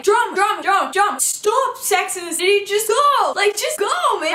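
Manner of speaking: loud, high mocking impatience at the friend; rude mockery. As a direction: mimic her rude brush-off, loud and high. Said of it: "being fucking so rude".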